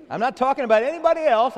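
loud, fast insistence; emphatic. Real, not acted. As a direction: press it home to the congregation. Preacher insisting to congregation.

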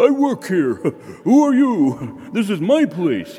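high, loud swaggering dog voice, slow on the question; a cocky yard dog bossing the stranger; territorial dog bravado. Retold or imitated. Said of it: "just a territorial kind of, you know, bravado".

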